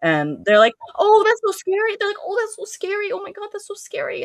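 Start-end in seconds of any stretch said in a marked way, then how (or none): 1.0-2.0 loud, very high bunny squeal; piercing high mock panic; mock frightened squeal. 2.2-4.2 very high-pitched bunny squeaking; tiny, hammed-up high panic; mock frightened squeal.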